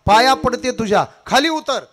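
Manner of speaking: high, loud pleading scream; mother screaming up at her son on the spire; desperate shout. Acted. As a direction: beg him to come down at the top of your lungs. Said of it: "जोरात ओरडली".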